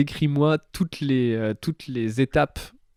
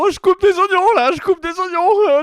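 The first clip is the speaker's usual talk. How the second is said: high, loud shout; 6 dB louder than usual. Retold or imitated. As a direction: call it out over the noise. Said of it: "J'étais là, ma cuisine".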